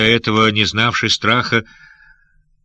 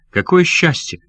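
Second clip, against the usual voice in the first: overjoyed exclamation; 8 semitones higher than usual. A narrator's reading. Pirate overjoyed at reunion.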